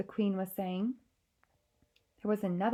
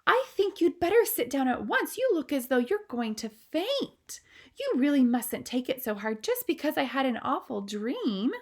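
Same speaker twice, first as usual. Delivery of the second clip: higher, firm royal orders; brisk commanding queen; 6 semitones higher, 5 dB louder than usual. Acted. Queen ordering shaken maid to sit, firm. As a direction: tell her to sit down at once. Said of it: "rather sharply".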